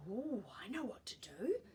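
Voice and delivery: whispered in a rush of discovery; breathy gasped whisper. Acted. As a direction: gasp it out under your breath. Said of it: "Te Rere Hua gasped".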